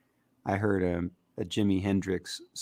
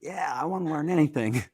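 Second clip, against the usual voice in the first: eager reply; 8 semitones higher than usual. Retold or imitated. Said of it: "be like".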